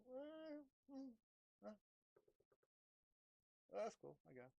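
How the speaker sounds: soft, unimpressed shrug; barely bother to answer it; bored dismissal. Retold or imitated. Brushing off the entity with bored indifference.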